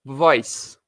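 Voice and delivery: "Voice" said as one syllable, no final vowel; model pronunciation. Shown as a demonstration. Teacher modeling "Voice" for students to repeat. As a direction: clip "Voice" short, ending on the s. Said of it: "eu quero que você repita a pronúncia".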